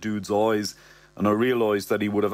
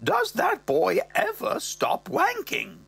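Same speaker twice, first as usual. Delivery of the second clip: mother's voice; 10 semitones higher than usual. Acted. Mother recounting the teen years, high voice. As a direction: pitch way up as the appalled mother. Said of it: "she goes".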